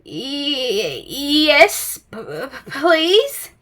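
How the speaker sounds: shaky, frightened stutter; trembling stammer. A narrator's reading. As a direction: quiver through the words. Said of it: "quivered and shook and said".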